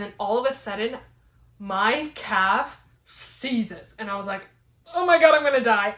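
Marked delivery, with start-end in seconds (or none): 4.9-5.9 fast, loud, higher outburst; mock-panicked rush, raised and loud; retold panic. Runner telling of a sudden calf cramp, retold panic.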